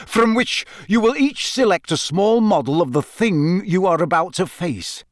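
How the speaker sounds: high, lively briefing; bright Bagman. A narrator's reading. Bagman briefing champions on their choice, bright. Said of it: "said Bagman brightly".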